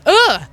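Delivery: disgusted groan. Real, not acted. Disgust at decomposing face.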